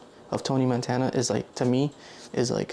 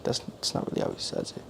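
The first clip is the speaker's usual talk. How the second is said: whispered aside; 6 dB quieter than usual. Real, not acted.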